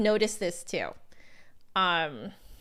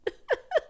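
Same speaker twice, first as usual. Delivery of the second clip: laugh. Real, not acted. Laughs at her pronunciation.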